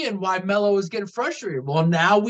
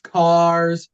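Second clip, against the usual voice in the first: normal talking voice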